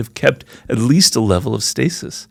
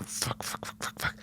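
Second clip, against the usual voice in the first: whispered frustrated swearing under the breath; a voiceless run of curses at your own clumsy hands; frustrated cursing; 20 dB quieter than usual. Retold or imitated. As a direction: hiss out the curses to yourself, barely audible. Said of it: "that kind of Tourette syndrome phase where I'm going".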